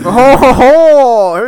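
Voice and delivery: jolly laugh. Acted. Jolly caller greeting.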